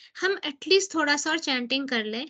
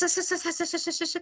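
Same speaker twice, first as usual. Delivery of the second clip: soft hissed "हंस, हंस" like a snake; hissing chant sound; 2 semitones higher, 6 dB quieter, faster than usual. Shown as a demonstration. Showing the class how the chant sounds like a snake's hissing. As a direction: hiss the syllables like a snake. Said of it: "hissing जैसे".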